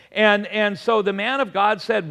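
ordinary delivery

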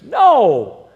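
loud, high preacher's shout; emphatic rejection. Real, not acted. Preacher rejecting what people think of forgiveness, emphatic shout. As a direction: burst out with a booming refusal.

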